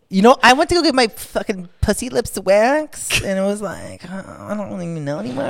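put-on high-pitched Valley girl accent; mock a California Valley girl. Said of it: "It's always a girl that talks".

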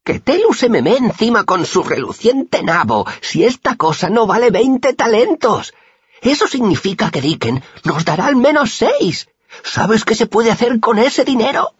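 blaspheme eagerly over the prize; greedy boasting. A narrator's reading. Crude boy blaspheming greedily.

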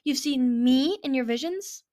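hesitant disbelief; nervous. A narrator's reading. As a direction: ask it in nervous wonder. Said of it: "said the nervous green dragon".

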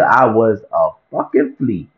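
normal talking voice

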